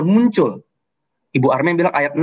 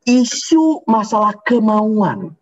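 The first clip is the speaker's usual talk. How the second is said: "isu masalah kemauan" drawn out slowly, each word weighted, higher; slow stressed key phrase; 5 semitones higher, much slower than usual. Shown as a demonstration. Preacher stressing his main point to the congregation, slow and weighty. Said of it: "Saya ulangi".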